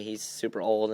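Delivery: ordinary talk, nothing marked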